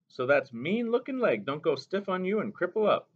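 put-on a gentle old mother reminding the boy; Mo Mo Bay. A narrator's reading.

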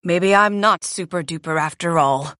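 low, deflated voice; sad. A narrator's reading. J P doubts himself after failing, sadly. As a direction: sink down, doubting yourself. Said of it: "J P says sadly".

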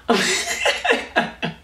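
high breathy giggle; breathy laugh. Real, not acted. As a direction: burst out in an airy giggle. Laughing after apology.

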